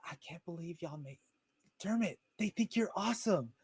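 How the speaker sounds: high, soft gushing; hushed excitement. Acted. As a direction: gush in a high, light voice. Character praises Dermot to his face.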